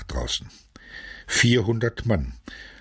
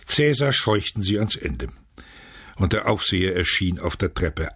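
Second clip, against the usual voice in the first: plain reading voice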